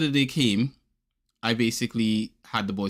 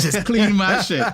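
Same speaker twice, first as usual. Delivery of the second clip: laughing joke; 6 semitones higher, 6 dB louder, slower than usual. Acted. Joking with dryer.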